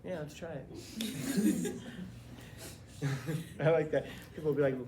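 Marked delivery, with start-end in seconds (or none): 1.8-3.5 quiet laugh. Real, not acted. Amused by a joke.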